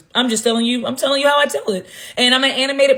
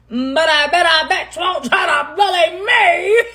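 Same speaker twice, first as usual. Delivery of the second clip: mock dare; 7 semitones higher than usual. Real, not acted. Daring critics, mock challenge.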